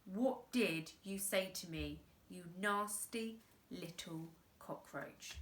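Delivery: soft, quiet menace; whisper-like threat at the cockroach; menacing hush. Acted. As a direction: crime boss quietly threatening the boy. Boss threatens the boy.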